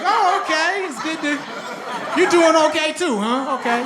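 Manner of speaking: high, excited nods of approval; mock surprised delight. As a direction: greet a stranger with pleased surprise. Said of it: "you're like".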